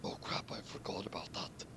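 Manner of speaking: soft whispered aside; hushed mock panic. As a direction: hiss it to yourself, caught out. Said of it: "Saber said".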